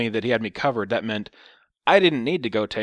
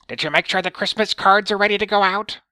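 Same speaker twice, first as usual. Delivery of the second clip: high, loud mock old woman; caricatured old lady, pitched way up; 11 semitones higher, 8 dB louder than usual. Old lady voiced in story.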